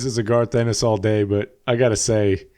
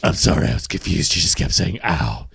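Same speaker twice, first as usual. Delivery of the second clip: low, husky superhero impression; deadpan low vigilante voice; Batman; 6 semitones lower than usual. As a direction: drop far down into a mock hero growl. Acts Batman replying to Alfred.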